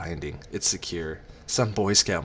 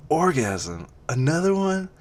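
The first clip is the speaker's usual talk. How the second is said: high, rising incredulous question; 11 semitones higher, 5 dB louder than usual. A narrator's reading. She answers his offer, incredulous. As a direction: echo it in disbelief, pitch shooting up.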